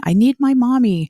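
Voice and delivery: lightly voiced crying toddler, pitched high; a hint of a small child calling for mommy; crying little boy. Two-year-old son crying for his mother, lightly voiced high child. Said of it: "he started crying".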